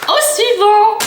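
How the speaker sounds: high, bright-pitched call for the next one; excited call-out. Real, not acted. Vlogger moving to the next snack, excited call. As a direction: call it out eagerly, pitched well up.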